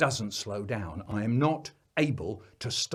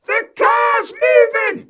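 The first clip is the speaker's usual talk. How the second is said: put-on very high, loud, slowed panicked child's scream; terrified child screaming; 15 dB louder, slower than usual. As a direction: shriek it as the car rolls away. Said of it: "He's screaming".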